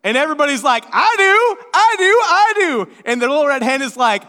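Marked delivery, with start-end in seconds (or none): 0.9-3.0 high, loud, excited clamour; hammed-up eagerness; eager volunteering. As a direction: pipe up like a whole crowd of eager animals. Animals eagerly volunteer for bread.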